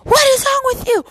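very high-pitched, loud scolding lady; shrill-pitched telling-off from an indignant lady; angry lady. Acted. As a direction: scold them as an irate, high-voiced woman. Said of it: "this lady with her teenage son and she's like".

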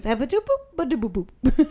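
babbled mock-French gibberish; Joey's fake French. Imitating Joey being taught French, confident gibberish. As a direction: bounce through nonsense syllables as pretend French. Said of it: "Phoebe tries to teach him French".

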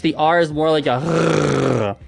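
throaty French R, gargled at the back. Shown as a demonstration. Teacher demoing a French R, gargled in the throat. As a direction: gargle the R like water. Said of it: "like you're gargling water".

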